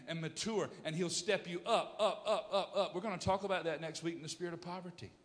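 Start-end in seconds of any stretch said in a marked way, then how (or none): none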